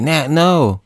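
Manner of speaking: high, pleading cry; mock-panicked protest; acted alarm. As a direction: call out as if under attack. Scott attacked by zombie Nat.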